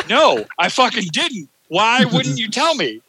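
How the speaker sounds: high, mock-annoyed complaint to the family; retold exasperation. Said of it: "I was like".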